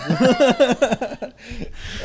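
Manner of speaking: high, loud burst of laughter; big high-pitched belly laugh. Real, not acted. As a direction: crack up laughing at your own remark. Laughing at his own remark about her.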